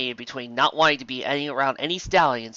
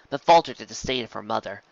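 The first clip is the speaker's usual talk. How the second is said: ordinary delivery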